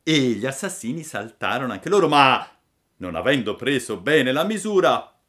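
ordinary delivery, nothing marked

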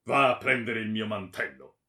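loud, commanding voice; stern order. A narrator's reading. Baron sending his henchman for his cloak, commanding. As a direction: give the servant a forceful command. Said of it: "poi ordinò al bravo".

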